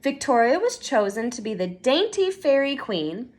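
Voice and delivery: usual speaking voice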